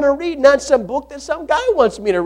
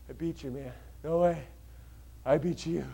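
exhausted whisper; 10 semitones lower, 22 dB quieter than usual. Retold or imitated. Exhausted on mat after match.

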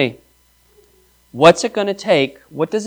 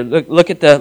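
ordinary talk, nothing marked

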